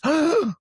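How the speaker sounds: loud high shocked gasp; sharp gasp. Retold or imitated. Reenacting therapist entering the room, sharp gasp. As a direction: gasp as if seeing something. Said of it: "It was this".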